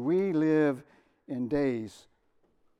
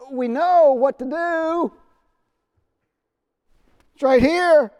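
loud, much higher, slow proclamation; preaching emphasis; 12 semitones higher, 15 dB louder, slower than usual. Real, not acted. Preacher to congregation, a loud slow proclamation. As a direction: boom it out to the congregation. Preacher drives the point home.

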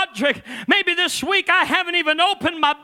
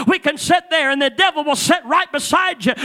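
ordinary talk, nothing marked

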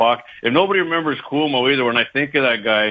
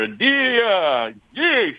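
"deer" drawn out high in a New York accent; 8 semitones higher, much slower than usual. Shown as a demonstration. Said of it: "Sounded like Andrew Dice Clay up there".

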